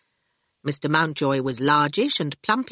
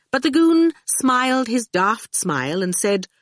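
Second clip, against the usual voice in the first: plain reading voice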